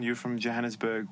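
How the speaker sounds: ordinary talk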